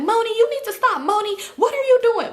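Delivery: high-pitched mock grandma; scolding grandma. Grandma walking in on the fight, high mock scolding. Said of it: "she like".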